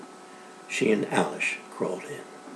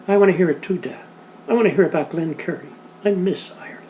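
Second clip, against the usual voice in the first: put-on pleading child; 6 semitones higher, faster than usual.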